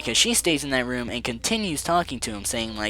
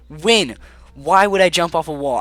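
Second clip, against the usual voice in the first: loud, higher, incredulous protest; exasperated retort; 4 semitones higher, 8 dB louder than usual. Retold or imitated. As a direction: push back hard, baffled and annoyed. Arguing with the teacher.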